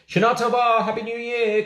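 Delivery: high, loud festive call. Acted. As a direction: call the greeting out from the train. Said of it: "called Engineer Harry".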